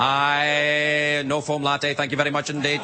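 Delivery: droning one-note auctioneer chant; auctioneer patter. Acted. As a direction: hold the first word, then chant on like an auctioneer. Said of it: "guys that talk like that".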